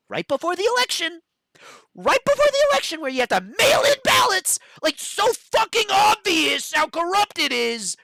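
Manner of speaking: rising into a high, loud shout; angry rant. Real, not acted. Ranting to a friend about the election, loud furious shout. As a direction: shout it out in outrage.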